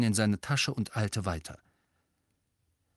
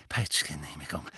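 tief und leise vor sich hin; 8 semitones lower, 6 dB quieter than usual. A narrator's reading. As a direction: keuchend zu sich selbst murmeln. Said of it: "fiebrig mit sich selbst redend". (fiebrig gemurmelt)

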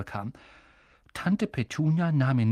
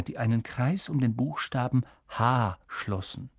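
ordinary delivery, nothing marked

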